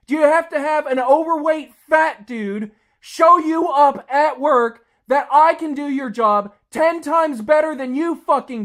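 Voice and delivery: raging at lazy coworkers; furious rant. Real, not acted. Ranting at security companies and lazy staff, furious shouting. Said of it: "angry as hell over this shit".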